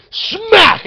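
sharp high loud smack noise; slap sound. Retold or imitated. The speaker imitating his open-hand slap, loud smack. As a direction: crack it out like a slap landing.

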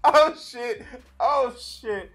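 high loud laughing exclamations; laughing loudly. Real, not acted. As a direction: crack up at your own joke. Streamer laughs at his joke.

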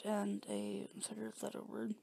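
whispered to herself; whispering. Real, not acted. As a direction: whisper it under your breath. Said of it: "Ten, a ten letter word".

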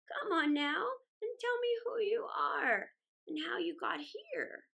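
put-on high, coaxing old woman's voice. A narrator's reading. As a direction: draw the child out gently. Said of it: "she said".

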